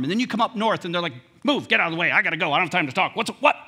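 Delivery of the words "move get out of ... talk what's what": brisk, mildly louder Michigan rush; blunt northerner. Retold or imitated. Northerners in a hurry, mock curt and direct. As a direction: brush people aside, a touch impatient. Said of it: "Very direct".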